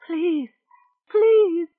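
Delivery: high, loud sobbing plea; tearful begging. Acted. Weeping Rachel begging Amos. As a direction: beg again, rising into a wail.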